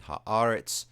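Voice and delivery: "haaretz" with a long, loud second A, the next to last syllable; penultimate stress. Shown as a demonstration. Teacher demonstrating the accent to students, "haaretz" with stress on next-to-last syllable. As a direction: draw out and punch the AR in "haaretz", then fall. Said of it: "they both have an accent on the next to last syllable".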